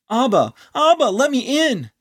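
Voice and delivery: high, loud, frantic pleading; desperate plea. Acted. Anon pounding on the door, begging Abba to let him in, frantic and loud. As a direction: beg at the top of your voice to be let in.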